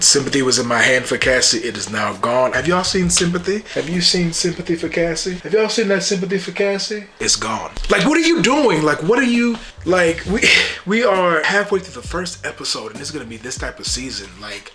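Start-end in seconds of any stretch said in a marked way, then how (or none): 2.5-7.1 high, mock-searching question to the audience; rising disbelief, asking around; incredulous. Real, not acted. Vlogger asking viewers, high and incredulous. Rhetorical outrage at Cassie. 7.9-11.8 high, louder outburst of disbelief; exasperated disbelief. Real, not acted. Vlogger yelling at Cassie on screen, high and exasperated.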